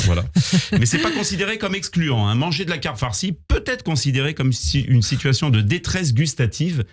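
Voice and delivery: ordinary talk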